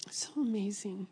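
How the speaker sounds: soft, whispery praise; hushed awe. Real, not acted. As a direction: drop to an awed near-whisper. Awed repeat, dropping to a hush.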